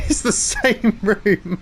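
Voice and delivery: slow words broken up by laughter; laughing. Real, not acted. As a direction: drag it out through a real laugh. Laughs while repeating the line.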